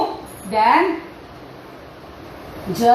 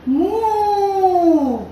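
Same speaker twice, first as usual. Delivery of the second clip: cow moo; 8 semitones higher than usual. Pretend. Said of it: "go down and say".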